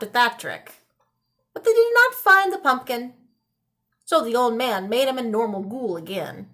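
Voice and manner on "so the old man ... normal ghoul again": usual speaking voice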